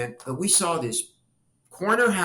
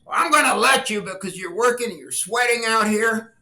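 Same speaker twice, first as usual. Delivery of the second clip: put-on a louder, higher folksy old man; voicing the homeowner; 7 semitones higher, 5 dB louder than usual. Homeowner letting the sign kids use his tree, folksy and louder. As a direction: give the kids permission with a big friendly voice. Homeowner voiced in retold story.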